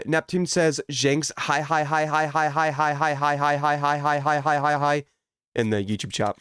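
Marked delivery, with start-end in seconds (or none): none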